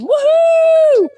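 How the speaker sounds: high whooping cheer; excited cheer. Real, not acted. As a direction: let out a big woohoo. Cheering at race seventy-two.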